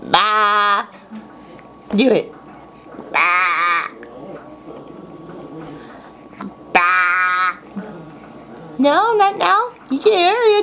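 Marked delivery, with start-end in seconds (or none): 0.0-7.6 sheep baa. Shown as a demonstration. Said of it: "You can make this noise really well".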